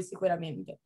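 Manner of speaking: ordinary talk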